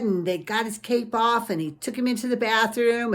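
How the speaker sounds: ordinary delivery, nothing marked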